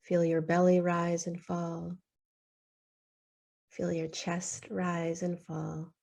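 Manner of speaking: normal talking voice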